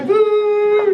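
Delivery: sudden high loud boo; jump-scare the Peter Pan rider; mock boo. Cop startling the comic at a stoplight, loud mock boo. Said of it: "He goes".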